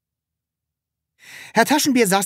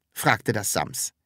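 ordinary delivery, nothing marked

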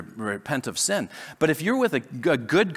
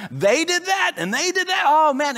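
high, whiny, envious voice; mock envious whine; 11 semitones higher than usual. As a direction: sound jealous of their vacation photos. Envying others' vacations on social media.

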